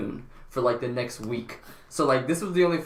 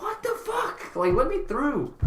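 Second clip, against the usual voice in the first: much higher, cracking into a laugh; laughing outrage; slower than usual. Retold or imitated. Reliving being told he must be searched, high laughing protest. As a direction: squeal the disbelief, then laugh.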